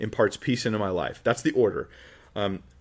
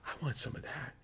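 longing hush; 15 dB quieter than usual. Retold or imitated. Listener longing for the saint's peace.

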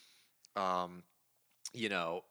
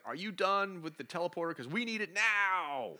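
high, insistent phone-call plea; hammed-up impatient demand; Alicia urgently demanding; 8 semitones higher than usual. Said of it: "Alicia calls Val at the home and is like".

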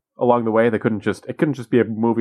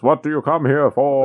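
higher, raised voice of a giant projected face; big booming wizard voice; 5 semitones higher than usual. Acted. Wizard's face on a giant screen challenges visitors, big voice. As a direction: boom it out as a big voice. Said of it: "big voice".

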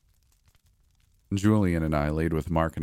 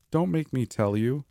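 put-on higher, small voice of a scared little boy; small child's voice; 8 semitones higher than usual. A narrator's reading. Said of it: "he said in a small voice".

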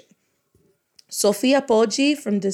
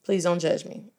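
ordinary talk

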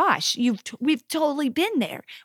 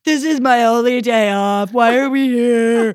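whiny son; 7 dB louder than usual. Retold or imitated. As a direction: whine it like a put-upon boy. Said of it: "this is his line that day".

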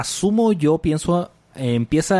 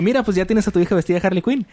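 chirpy, upbeat delivery; excited quote; 7 semitones higher, faster than usual. Retold or imitated. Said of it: "De que".

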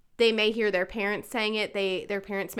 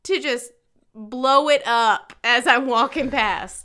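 louder, higher outraged rant; indignant mom; 5 semitones higher, 9 dB louder than usual. Retold or imitated. Mom ranting at cursing kids by the school office, loud indignant. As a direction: vent like a fed-up mom. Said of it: "And I'm just like".

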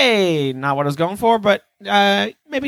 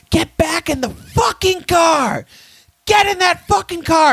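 high, furious mock shout at full force; yelling cop; slower than usual. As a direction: bark it like an angry cop ordering you back. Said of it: "he goes".

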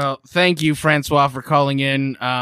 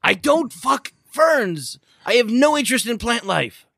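exasperated denial; 9 semitones higher, slower than usual. Real, not acted.

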